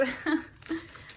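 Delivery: airy breathless laugh; breathy laugh. Real, not acted. Laughs about being out of breath.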